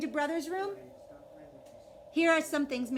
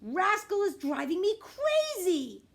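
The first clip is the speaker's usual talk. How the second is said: exasperated; 9 semitones higher, 6 dB louder, slower than usual. Acted. Sibling complaining.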